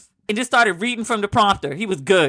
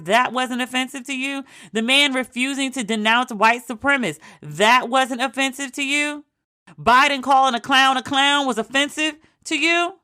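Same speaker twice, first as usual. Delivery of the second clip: high, disbelieving rant; pitched-up exasperated questioning; incredulous; 6 semitones higher than usual. Acted.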